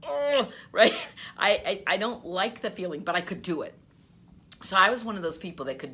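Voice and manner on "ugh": disgusted ugh. Retold or imitated. Inner disgust at pushy selling.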